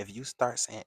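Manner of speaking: usual speaking voice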